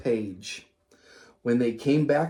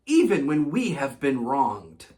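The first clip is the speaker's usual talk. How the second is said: usual speaking voice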